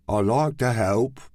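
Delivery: flat, even delivery; droning, sleepy monotone. Acted. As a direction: say it with no lift at all. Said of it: "in his monotone voice".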